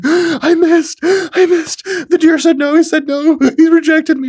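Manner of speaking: high, loud hysterical fake crying; mock sobbing. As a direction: wail it like a hammed-up crybaby. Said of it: "wipe your tears".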